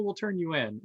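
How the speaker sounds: ordinary talk, nothing marked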